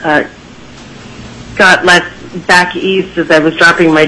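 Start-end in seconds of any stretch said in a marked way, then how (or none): none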